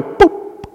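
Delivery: flick it away with a breathy boop; amused boop. Pretend. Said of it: "You would laugh and go".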